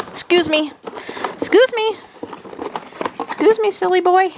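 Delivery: put-on high-pitched baby-talk scolding, playful. Mother coos at her baby doll, high playful baby talk. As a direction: coo at the doll like a fond mom.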